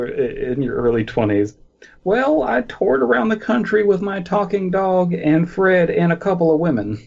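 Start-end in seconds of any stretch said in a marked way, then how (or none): 2.0-7.0 character voice. Acted. In-character answer, high voice.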